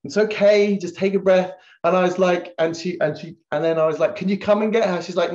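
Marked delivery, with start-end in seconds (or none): 0.0-1.5 high, loud imitated child; six-year-old daughter. Daughter telling flustered Daddy to calm down, imitated child. 4.2-5.0 high, loud appeal for help; retold calling out. Dad calling elder daughter to fetch the toddler, calling out.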